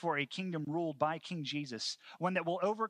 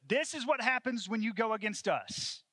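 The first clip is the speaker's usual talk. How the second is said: higher-pitched forceful threat; emphatic mock proclamation; raised warning; 8 semitones higher, 5 dB louder than usual. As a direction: voice the occupiers warning the crowd, pitched up. Voicing the Romans' public warning.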